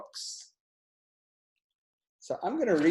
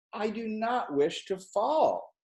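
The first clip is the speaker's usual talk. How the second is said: higher, slow pleading fish; frightened fish; 8 semitones higher, much slower than usual. A narrator's reading. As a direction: plead not to be dropped.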